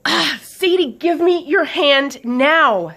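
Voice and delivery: loud, high yell, words drawn out; urgent yell. Acted. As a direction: yell a slow, desperate command up the stairs. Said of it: "Swift yelled up the stairs again".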